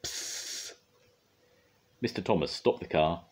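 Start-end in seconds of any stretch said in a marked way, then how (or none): 0.0-0.7 put-on breathy voiceless psst from the baby; a tiny unvoiced hiss of a word; baby's psst. A narrator's reading. The baby says it to Mog: voiceless psst. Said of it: "It said".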